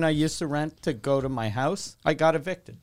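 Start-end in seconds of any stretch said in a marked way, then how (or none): none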